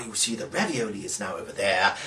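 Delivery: ordinary delivery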